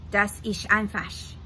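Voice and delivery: put-on German words in a thick German accent. Gutenberg answers Miguel in German, thick German accent. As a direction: say it simply in German. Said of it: "a thick German accent".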